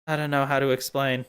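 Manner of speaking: ordinary talk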